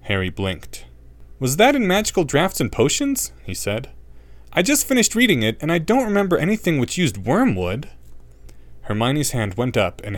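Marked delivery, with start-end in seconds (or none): 1.4-7.9 put-on boy voice. A narrator's reading. Harry's higher boy voice.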